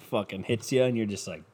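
usual speaking voice

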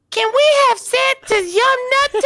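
put-on high-pitched kid begging for a treat; mock child's voice, giggly and high; excited child. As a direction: squeal it like an eager little kid. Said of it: "your kids run through the door".

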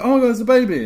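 high, loud discovery cry; mock surprise. Acting out finding a baby, mock surprise. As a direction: exclaim as if just spotting it.

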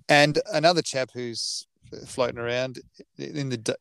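normal talking voice

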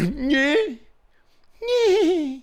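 high, slack-mouthed "nyeh"; twisted-face mock noise. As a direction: mimic a contorted jaw with a whiny bleat.

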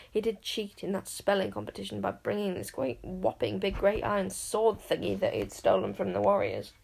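ordinary delivery, nothing marked

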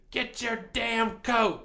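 slow, high mock yell; imitating mom's yell. Mom scolding her kid about his coat, slow high mock yell. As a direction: drag it out like a fed-up mom. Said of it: "she told me to".

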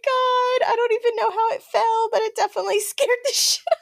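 high breathless laughter near tears; laughing so as not to cry; laughing into sobs. Real, not acted. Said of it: "Sorry, I'm laughing so I don't cry".

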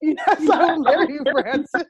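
laughing through the answer; laughing yes. Real, not acted. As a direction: giggle while saying yes. Accepting proposal while laughing.

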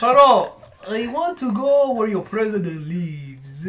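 put-on slow, vaguely Russian accent; stilted mock foreigner, starting high and loud; botched foreign accent. Foreigner asking for the president, slow hammed-up accent. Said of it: "closest you got to a Russian accent".

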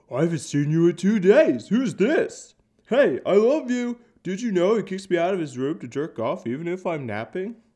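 high, loud, tattling dog voice; excited dog voice. Retold or imitated. Dog greeting its owner's date, high tattling voice. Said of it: "The dog would be like".

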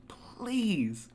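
high, drawn-out please; imploring, stretched plea; pleading. Real, not acted. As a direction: beg with exaggerated desperation. Begging people not to talk.

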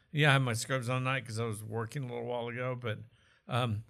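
normal talking voice

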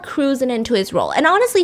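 usual speaking voice

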